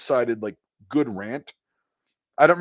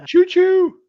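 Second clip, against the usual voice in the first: put-on very high, loud childlike Choo Choo; squeaky baby voice; 9 dB louder than usual. Friend echoing the little boy's word in a tiny high voice. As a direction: squeal it like a delighted toddler.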